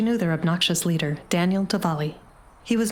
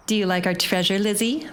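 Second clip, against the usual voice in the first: mocking. A narrator's reading. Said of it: "the red shirt-wearing leader mocks".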